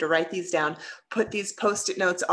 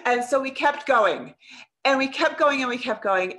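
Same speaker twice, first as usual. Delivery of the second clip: ordinary delivery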